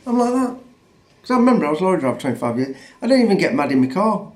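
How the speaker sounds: ordinary talk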